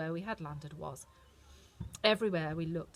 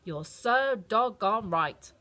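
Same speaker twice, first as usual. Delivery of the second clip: louder, slow doggy bark-talk; woofed; 3 semitones higher, 8 dB louder, much slower than usual. A narrator's reading. Toto agrees with Dorothy, woofing. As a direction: say it like a dog woofing. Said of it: "I woofed".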